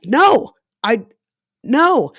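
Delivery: loud, high cry of no; retold sharp refusal. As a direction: push him off with a loud no. Retold refusal during sex.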